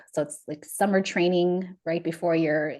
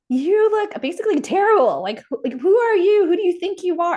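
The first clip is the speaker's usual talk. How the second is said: loud high barking drill sergeant; louder, higher mock drill yell; 10 semitones higher, 7 dB louder than usual. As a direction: yell it down at a sloppy cadet. Drill cadet yelling at her.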